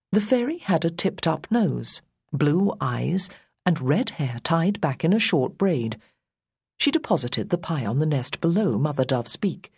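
ordinary delivery

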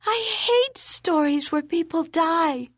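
put-on high, slow, sulky child's voice; a young girl protesting the sad ending; upset little girl. Keiko's child voice.

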